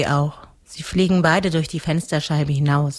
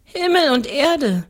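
put-on higher little-girl answer; seven-year-old girl; 11 semitones higher, 3 dB louder than usual. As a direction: answer simply in a raised child's voice. Girl answers the ghost's question. (kindliche Mädchenstimme)

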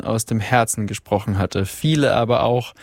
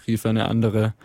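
usual speaking voice

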